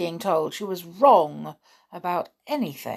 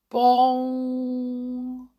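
high, sustained ringing bong; clock bell chime; 5 semitones higher, 3 dB louder than usual. A narrator's reading. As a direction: imitate Big Ben, holding the note. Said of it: "Ben began to chime".

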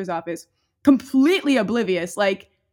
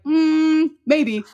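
long high hum before an offhand answer; mock hesitation; 6 semitones higher, 5 dB louder than usual. Parents answering a child's question on dying, mock hesitation. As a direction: hum as if weighing it up. Retold answer to whether she'll die.

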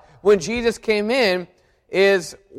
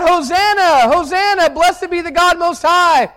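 high, ringing hosannas of a cheering crowd; crowd crying out; 7 semitones higher than usual. Retold or imitated. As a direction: call it out like the jubilant crowd.

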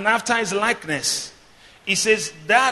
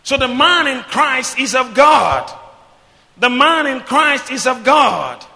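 loud, measured proclamation with raised pitch; full-force preaching; 6 semitones higher, 6 dB louder than usual. Real, not acted. Preacher telling believers who they are in Christ, full-force preaching. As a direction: declare it firmly to the whole church.

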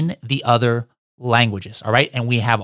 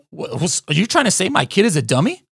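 mock offended parent; 6 semitones higher than usual. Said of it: "You might be like".

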